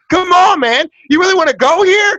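loud, high, furious shouting; heated shouting. Real, not acted. Arguing friend shouts him down in anger. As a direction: yell it at him in outrage. Heated argument about concentration camps.

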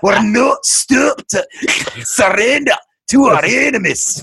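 slow, hammed-up Scottish accent. Friend asked to do his Scottish, mock-defiant Scottish accent. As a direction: put on a rousing, drawn-out Scottish brogue. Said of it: "do your Scottish accent".

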